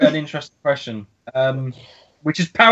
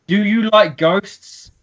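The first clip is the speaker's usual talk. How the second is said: slow question; 4 semitones higher, much slower than usual. Acted. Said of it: "I'm gonna ask you a paranormal question".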